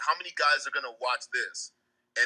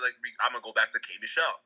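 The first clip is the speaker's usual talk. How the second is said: ordinary delivery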